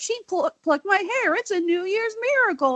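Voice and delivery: high, gleeful mock exclamation; gleeful quote. Voicing the comic's cutesy heroine line.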